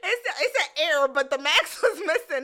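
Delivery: laughing, loud and high. Real, not acted. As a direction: crack up mid-story. Laughing at fake sneakers.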